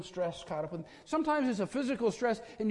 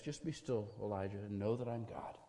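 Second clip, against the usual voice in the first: much softer and lower, hushed; still small voice; 9 semitones lower, 11 dB quieter than usual. Acted. God speaks softly to calm Elijah. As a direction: breathe it like a gentle whisper of God.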